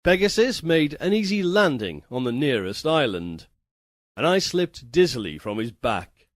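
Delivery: usual speaking voice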